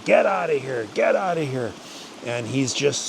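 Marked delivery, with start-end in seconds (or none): 0.0-1.7 high, forceful yell to drive him off. Retold or imitated. Hunter yelling at a charging grizzly, high and forceful. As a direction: bark it up high at the bear. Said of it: "And I'm yelling at him".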